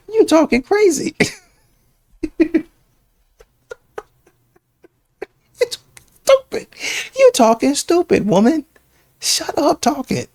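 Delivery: shrill-high jeering at her, far above normal; high mocking scold. Real, not acted. Jeering at the TikTok woman, high scolding voice. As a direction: squeal the insults up high.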